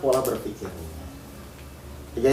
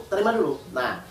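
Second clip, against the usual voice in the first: ordinary talk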